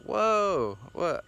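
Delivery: imitating a high startled whoa; mock sudden surprise; hammed-up startled falling cry; surprised. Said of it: "Not like".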